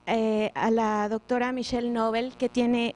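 ordinary delivery

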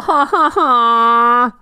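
long drawn-out ha-ha-ha; laughter. Real, not acted. Teammate after asking why he takes everything, long laugh. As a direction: let out a long, high-starting laugh.

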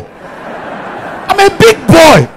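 put-on high, slow drawn-out big-boy boast; big boy swagger. Comedian flaunting to the audience, high and slow. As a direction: stretch out big boy like a show-off.

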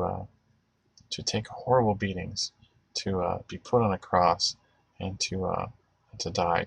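soft, earnest prayer to the Lord; spoken prayer. Real, not acted. Host praying aloud to the Lord for the viewers, earnest and soft. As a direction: pray quietly and sincerely, with long pauses.

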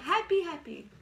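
high, playful happy squeal; exaggerated high-pitched glee; demonstrating happy. Shown as a demonstration. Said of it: "So let me show you".

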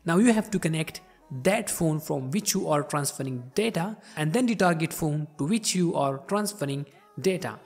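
ordinary talk

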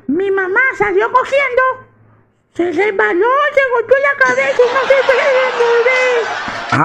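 aguda y lenta de niño pequeño; voz de niño. Acted. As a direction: habla como un niño inocente. Niño cuenta lo sucedido. (niño muy agudo contando a su papá)

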